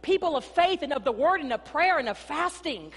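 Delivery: loud, rising preacher's cry; preaching at full force. Acted. Pastor preaching to the church, loud and fervent. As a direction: preach it out to the congregation.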